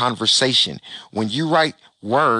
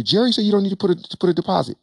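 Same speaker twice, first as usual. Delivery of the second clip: mock client voice; 9 semitones higher, faster than usual. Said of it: "Somebody say".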